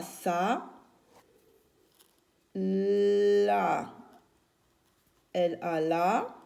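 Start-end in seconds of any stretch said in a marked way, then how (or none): 2.5-3.9 "La" stretched very long on one held note; syllable sounded out. Shown as a demonstration. Teacher writing the word for children, "La" held long. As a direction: draw out both L and A in "La".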